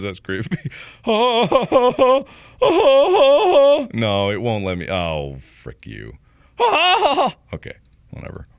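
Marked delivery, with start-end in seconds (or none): 1.0-3.8 loud falsetto staccato cackle; over-the-top creeped-out cackling; high mock wailing ha-has. Mock cry at creepy picture. 6.6-7.3 short, very high hysterical cackle; sudden over-the-top high cackle; loud falsetto ha-has. Pretend. Mock cry at picture.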